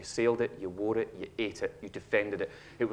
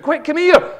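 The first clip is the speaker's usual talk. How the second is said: a loud, much higher shout calling someone; 15 dB louder, slower than usual. Shown as a demonstration. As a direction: yell it out to bring the whole house running. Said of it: "roll yourself up and shout".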